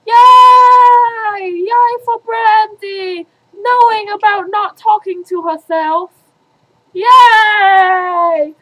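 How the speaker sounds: high, loud, sung-out yay; loud cheer. Acted. Cheering for Brandy, loud excited yay. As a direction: cheer her on like a fan.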